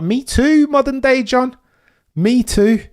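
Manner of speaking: high, loud excited outburst; emphatic agreement. Real, not acted.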